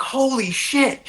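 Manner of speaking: high-pitched retold shock; stretched, high-pitched exclamation of alarm; freaking out. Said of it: "freaking out".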